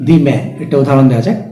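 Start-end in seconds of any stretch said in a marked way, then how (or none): 0.1-0.4 "the man" with "the" said as a stressed "dee"; emphatic "thee". Shown as a demonstration. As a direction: lean on "the" in "the man", rhyming it with see. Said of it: "সেন্টেন্সে খুব গুরুত্ব দেওয়ার".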